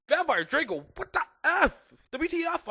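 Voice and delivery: high, squealed disbelief; shocked outcry. A narrator's reading. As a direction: cry out in high-pitched outrage. Shocked character confronts fighting pair.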